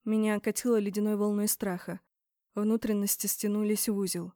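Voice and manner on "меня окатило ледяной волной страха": ordinary delivery, nothing marked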